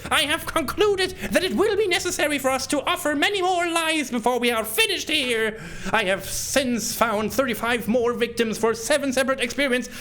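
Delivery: high, manic villain reading a diary; crazy voice. Acted. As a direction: cackle through it like a possessed madman. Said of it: "read all this in my crazy voice".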